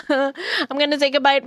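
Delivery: ordinary delivery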